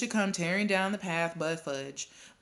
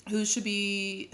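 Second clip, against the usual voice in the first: ordinary delivery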